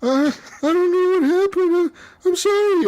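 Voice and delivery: very high, meek whimpering robot voice; crying robot. Retold or imitated. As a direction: plead like a scared machine, half-mock. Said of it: "the robot was crying".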